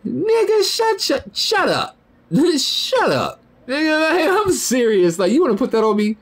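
squeaky falsetto, incredulous and laughing; high-pitched mock outrage at an accusation; mocking disbelief. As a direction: scoff in a very high voice. Mocking comeback to mom's accusation.

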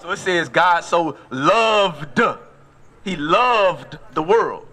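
"loved" stretched out slowly and weighted; slow, deliberate teaching with "loved" picked out; stress on loved. Shown as a demonstration. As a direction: lean hard and long on the word "loved". Preacher dwells on past tense.

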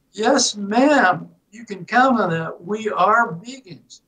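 high, put-on Southern accent; best Southern accent. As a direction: answer with mock Southern courtesy and certainty. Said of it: "in my best Southern accent".

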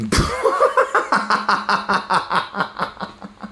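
soft unvoiced wheezing laugh; breathless laugh. Real, not acted. Streamer laughs at a loss, soft wheezing laugh.

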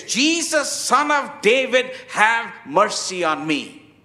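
high, emphatic shout that falls away on the plea; crying out loud. Retold or imitated. Blind beggar calling to Jesus as he passes by, crying out loud. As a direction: cry out loudly to catch someone's attention. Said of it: "He starts shouting, crying out loud".

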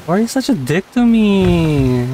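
high, put-on whine; mock hurt. Complaining to a friend about being treated badly, mock-hurt whine.

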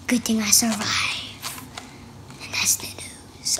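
soft whispered sign-off; hushed whispered aside. Real, not acted. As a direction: whisper it like a secret ending. Child whispers ending aside.

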